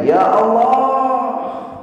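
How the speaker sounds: long, high drawn-out cry; shout to the sea. Pretend. Angry man venting at the seashore, long high cry. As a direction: let it out across the water.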